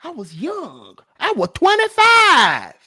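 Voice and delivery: mocking a whiny excuse, jumping high on the age; hammed-up imitation of him excusing himself; sing-song mock plea. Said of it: "Made an excuse".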